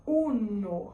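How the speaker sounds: "Uno" with clear Spanish vowels; Spanish pronunciation. Shown as a demonstration. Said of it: "em espanhol".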